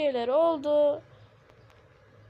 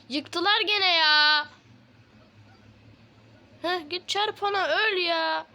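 exasperated whine; 5 semitones higher, 5 dB louder, slower than usual. Real, not acted. Base destroyed again.

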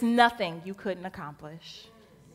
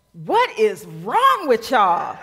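slow exasperated rebuke, louder; angry Jesus; 5 dB louder, much slower than usual. Acted. Jesus angrily scolds his disciples, slow exasperated rebuke. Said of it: "Jesus turns to his homies angrily and says".

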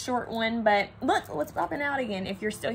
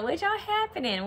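lightly voiced Southern drawl on "y'all"; a higher, playful Southern country twang; 9 semitones higher than usual. Repeating her odd phrase for viewers in a mild Southern drawl. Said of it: "Why did I say it like that?".

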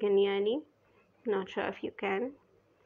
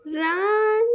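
high crying yell to flee; panicked scream; 9 semitones higher, 8 dB louder than usual. Real, not acted. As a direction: scream it in real fear. Caught by beast.

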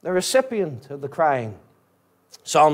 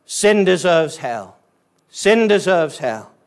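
usual speaking voice

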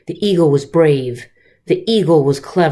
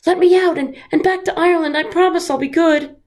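high, desperate begging; pleading; 13 semitones higher, faster than usual. Acted. Snake begging Patrick from inside the box, high pleading. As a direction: promise anything to get free. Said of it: "the snake cried".

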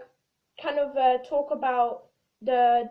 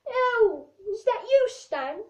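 mock surprise; 6 semitones higher, 4 dB louder than usual. Surprised phone greeting.

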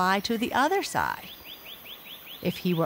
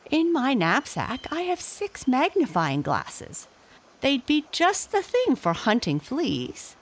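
a touch higher, polite; lightly voiced father; 8 semitones higher than usual. A narrator's reading. My father to the gorilla, lightly voiced and polite. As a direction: offer help politely. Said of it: "said my father".

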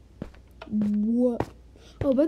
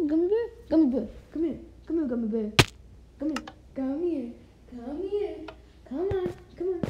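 high, sweet coaxing to the dog; coaxing pet voice; 5 semitones higher, faster than usual. Real, not acted. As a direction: coax the puppy over.

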